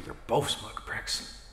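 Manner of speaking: slow whispered sneer; whispered insult. Acted. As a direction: hiss it so they can't hear. Grumbling at fan across room.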